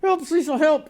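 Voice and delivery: high mock calls for help; mock cry for help. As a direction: cry out like someone sinking. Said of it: "sink, go".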